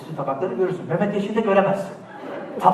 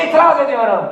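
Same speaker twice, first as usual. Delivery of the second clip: higher, louder courtroom cry; movie lawyer objection; 7 semitones higher, 8 dB louder than usual. Pretend. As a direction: object like a film attorney. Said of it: "Ben bir Amerikan filminden çıktım". (Amerikan filmi avukatı gibi yüksek sesle itiraz)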